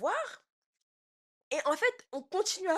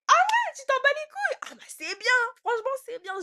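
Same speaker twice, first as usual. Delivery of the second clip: much higher, louder sarcastic whine; much higher mock voice of a sarcastic stepmother; 13 semitones higher, 8 dB louder than usual. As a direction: parody her sneering reply. Mocking stepmother's sarcastic reply.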